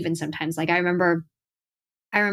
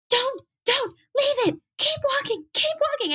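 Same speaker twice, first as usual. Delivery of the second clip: much higher, urgent hushed commands; intense PR handler. Retold or imitated. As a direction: rush her along like a nervous handler. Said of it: "it was so intense that it scared me".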